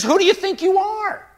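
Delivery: high, loud, indignant outburst; unhesitating rebuke. Acted. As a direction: explode at the interrupter. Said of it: "Jesus doesn't even hesitate".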